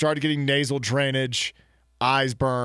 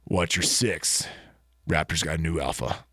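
mock tough raptor trainer, pitched down; movie-hero impression; 11 semitones lower, 3 dB quieter than usual. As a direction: deliver the film line deep and deliberate. Impression of Jurassic World lines.

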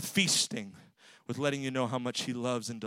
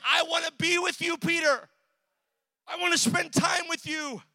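loud, high, pleading shout as Jesus; Jesus pleading loudly; 13 semitones higher, 4 dB louder than usual. Acted. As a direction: cry out to Peter with longing. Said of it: "He was saying".

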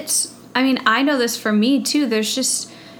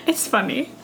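normal talking voice

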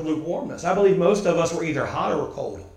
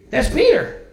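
higher, ringing "That's Peter" with a rise; passionate Peter; 10 semitones higher than usual. Shown as a demonstration. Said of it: "the passionate Peter".

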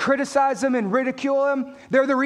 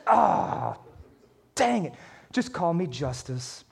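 low, soft, resigned letdown; mock deflated; 8 semitones lower, 6 dB quieter, slower than usual. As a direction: give up in comic disappointment, voice dropping away. Mistaken namesake gives up, deflated.

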